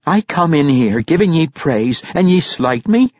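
high-pitched, affronted question; incredulous dwarf mother, slighted. A narrator's reading. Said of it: "Yuene asked incredulously".

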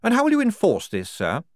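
high, loud, pointed question; challenging major. Acted. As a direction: challenge a superior officer.

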